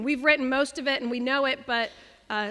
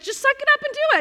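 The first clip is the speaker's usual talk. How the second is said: much higher, louder mock scolding; bossy friend; 14 semitones higher, 8 dB louder, much faster than usual. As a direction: toss it off like a bossy best friend. Friend Kat telling her off.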